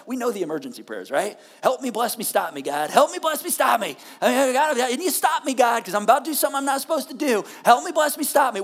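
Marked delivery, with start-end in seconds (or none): 2.9-4.0 higher and louder, panicked; hammed-up desperate Help me, bless me, stop me; frantic plea. Mock emergency prayer, escalating. 4.2-8.6 higher, louder and quick, hammed-up panic; mock desperate shouted prayer; frantic plea. Mock emergency prayer continues.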